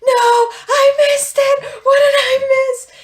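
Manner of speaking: high, loud, drawn-out wail; re-enacted panicked scream. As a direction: act out screaming in dismay at missing the big play. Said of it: "starts screaming, and I'm like".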